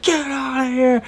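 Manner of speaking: thin-volume, high-pitched retold shout; mock distant scream. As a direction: imitate someone screaming from across a river. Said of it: "across the river just screaming".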